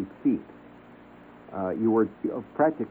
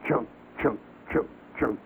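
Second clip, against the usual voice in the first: chunks doubled up fast, a bit softer; quick chopping sound; 3 semitones higher, 4 dB quieter than usual. Retold or imitated. Describing the bug's extra quick chops, quick axe mimicry. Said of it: "a couple of extra quick chops".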